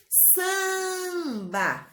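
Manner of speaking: "Samba" with the first syllable held long; stretched syllable. Shown as a demonstration. Teacher showing children a long syllable, stretched "Samba". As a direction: draw out the "Sam" in "Samba".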